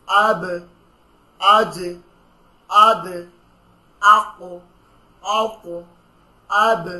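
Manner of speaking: "আব", "আজ", "আদ" each closed with a loud bounced stop; call-and-repeat tajweed drill; qalqala practice. Shown as a demonstration. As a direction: push off each final consonant. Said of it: "সবাই আমার সাথে একটু পড়েন".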